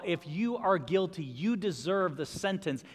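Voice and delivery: ordinary delivery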